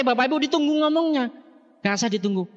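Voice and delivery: normal talking voice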